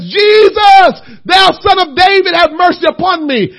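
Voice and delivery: high, loud cry for mercy; screaming out. Retold or imitated. Blind Bartimaeus calling to Jesus, a loud high cry. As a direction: call out to Jesus across the road. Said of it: "Why are you screaming at Jesus?".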